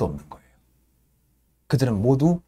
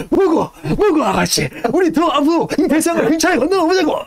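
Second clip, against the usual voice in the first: much higher, louder, laughing; gleeful stoker; 4 dB louder than usual. Retold or imitated. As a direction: cheer the furnace on with a grin. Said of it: "이러면서 막".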